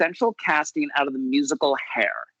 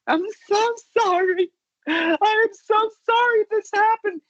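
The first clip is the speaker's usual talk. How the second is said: very high, wailing mock sob; tearful apology. Mother apologizing to the run-over cat, high tearful wail. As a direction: imitate a weeping mother, pitched way up. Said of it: "my mom starts talking to the cat".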